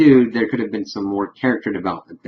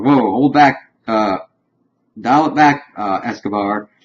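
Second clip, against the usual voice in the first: playful whoa; 2 semitones higher, 6 dB louder than usual. Retold or imitated. Friend calming her down.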